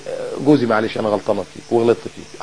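soft, low conciliatory murmur; sweet wifely apology. Acted. Wife apologising to her husband, soft and sweet. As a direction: play a wife gently making up. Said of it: "ما تتكلمي معاه كلام حلو".

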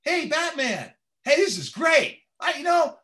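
very high, loud, excitable hero. Acted. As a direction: push it way up, squeaky and overeager. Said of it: "can you do a little higher voice?".

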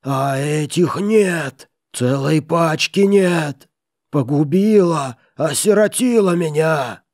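loud, high, slow enraged wail; furious howl. A narrator's reading. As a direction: howl in miser's fury. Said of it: "озлобленный вопль рычания".

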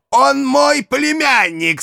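high, drawn-out triumphant claim. A narrator's reading. Uncle claiming the prisoner as nephew, triumphant. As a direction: play the trump card, slow and high. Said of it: "с торжествующим видом".

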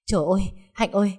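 rushing panic over a fainted brother; frightened cry. Acted. Sister shaking her unconscious brother, fast panicked calls. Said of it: "sợ hãi".

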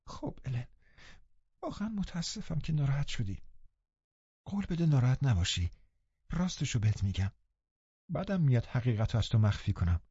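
mildly tearful plea to be forgiven; tearful apology. Acted. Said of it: "زد زیر گریه". (عذرخواهی با کمی بغض، آهسته و زیرتر)

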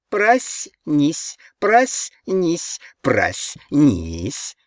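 high, eerie echoing chant; high-pitched jeering refrain; second mocking chorus. A narrator's reading. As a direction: chant it like a taunting pack, dropping at the last. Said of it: "подхватил второй хор".

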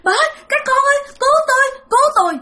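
very high, rushed pleading for rescue; husband crying to his family for help; desperate cry for help. Retold or imitated. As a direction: beg for your life in panic. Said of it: "Mà ông Chính làm vậy".

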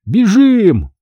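high, loud call to run; urgent shout. A narrator's reading. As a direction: shout it to your friends as you bolt. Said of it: "крикнул Грег Ципи и Майклу".

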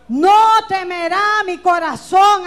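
loud, slow, ringing declaration; shouted proclamation. Real, not acted. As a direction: declare it to the whole church. Preacher shouting verse. (declaración gritada y lenta)

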